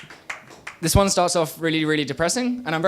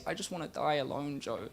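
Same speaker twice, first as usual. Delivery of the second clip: soft, low, defeated; a hushed, hopeless murmur to Joe; quiet despair; 3 semitones lower, 11 dB quieter than usual. Acted. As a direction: let it fall almost to nothing. Same line, now quiet.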